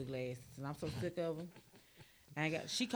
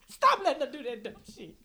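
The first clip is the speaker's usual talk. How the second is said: high, loud scolding through laughter; laughing scold; 7 semitones higher, 9 dB louder than usual. Retold or imitated. Scolding the cousins about the photo, laughing loudly. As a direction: scold them while cracking up.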